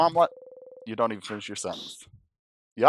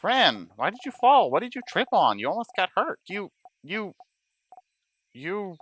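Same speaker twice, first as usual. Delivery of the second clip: put-on upset child voice; 7 semitones higher, 4 dB louder than usual. Bonnie upset after the fall.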